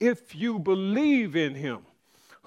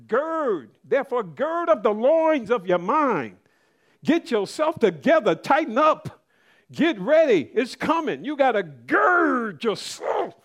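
rising, louder preacher's exhortation; fervent preaching; 4 semitones higher, 6 dB louder than usual. Acted. Preacher exhorting the church to gird themselves, rising. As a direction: drive the call home to the congregation.

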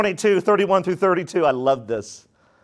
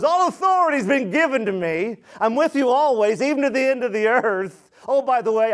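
higher-pitched proclamation; emphatic, raised sermon voice; preaching Jesus' words; 8 semitones higher than usual. Real, not acted. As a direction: declare it as a promise to the congregation.

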